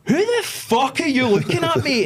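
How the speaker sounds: high loud mock-aggressive yell; retold gym confrontation shout; aggressive challenge. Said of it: "Again, I went".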